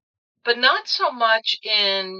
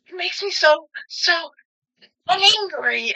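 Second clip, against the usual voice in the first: much higher, squeaky cartoonish anger; Amy Adams in Enchanted; 10 semitones higher than usual. Quoting Amy Adams to the lawyer, squeaky and much higher. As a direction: sputter it like a princess too sweet to be mad. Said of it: "it's really, really funny".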